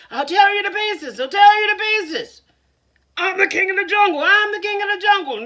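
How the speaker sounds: loud high echoed threat. Acted. Echo in the well throws back the threat, loud and high. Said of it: "he heard".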